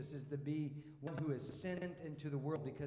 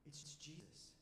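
breathless whispered gasp of wonder; gasp, then breathe the name in awe; hushed amazed whisper; awed gasp; 15 dB quieter, slower than usual. Retold or imitated. Disciples seeing risen Jesus.

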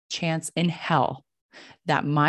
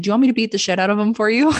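fast, loud, higher and climbing; rapid-fire, cheeky rising question; eager offer to fight; 4 semitones higher, 6 dB louder, much faster than usual. Retold or imitated. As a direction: blurt the offer in a rush.

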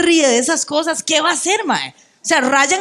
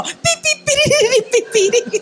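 mock chatter; 8 semitones higher than usual. Mocking chatter sound.

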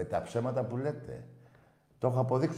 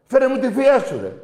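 loud, high taunting yell; mocking shout; 14 semitones higher, 13 dB louder than usual. Real, not acted. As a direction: hurl the taunt. Mocking the caller loudly.

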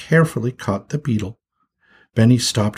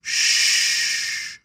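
long unvoiced shush; steady hissed "shh". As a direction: hush someone with a drawn-out shh. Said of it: "looked down and shushed him".

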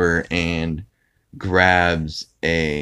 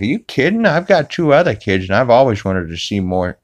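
lightly put-on excited Peter, higher; a hint of an eager cartoon dad; 5 semitones higher, 3 dB louder than usual. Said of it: "And Peter's like".

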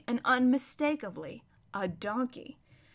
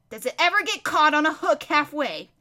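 put-on raised, loud boyish question; cheeky boy; 5 semitones higher, 6 dB louder than usual. Boy taunting the ranting prince, cheeky. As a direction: heckle with an innocent question.

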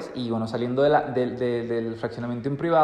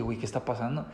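scared inner voice; 4 semitones lower, 9 dB quieter, faster than usual. Retold or imitated. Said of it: "Me entró así como un miedo".

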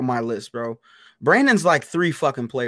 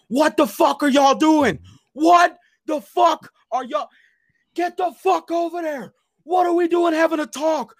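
furious yelling; 14 semitones higher than usual. Retold or imitated. Yelling at teammates chatting on the sideline, furious shout. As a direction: scream at them like a drill sergeant. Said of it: "swearing the whole fucking way back".

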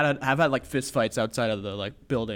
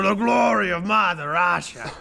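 put-on Russian accent; 5 semitones higher, slower than usual. Friend echoes the patriotic toast, bad Russian accent. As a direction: proclaim it in a hammed-up Russian accent. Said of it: "what's a Russian accent".

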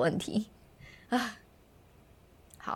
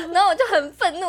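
laughing; 9 semitones higher, 5 dB louder than usual. Real, not acted.

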